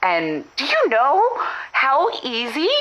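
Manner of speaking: usual speaking voice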